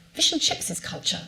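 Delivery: put-on high old-fashioned 1930s lady voice. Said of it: "I can imagine her in a nineteen thirties voice".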